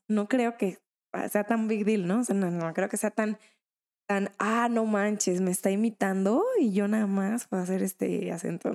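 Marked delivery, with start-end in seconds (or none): none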